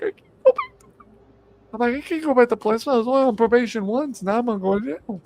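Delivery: very high, squeaky panicked protest; mock panic. Son refuses mother to open for police, mock panicked. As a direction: plead in a hammed-up high panic.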